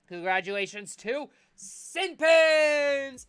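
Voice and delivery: slow, high and loud drawn-out reveal; drawn-out announcement. Acted. Host crowning the Knights captain, slow loud announcement. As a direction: stretch out the congratulations like a ring announcer.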